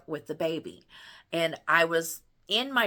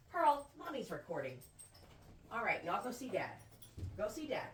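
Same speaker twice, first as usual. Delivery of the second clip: gentle shooing; 4 semitones higher, 8 dB quieter than usual. Real, not acted. Shooing kids off softly.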